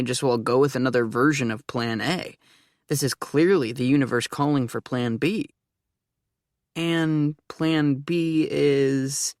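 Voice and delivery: ordinary delivery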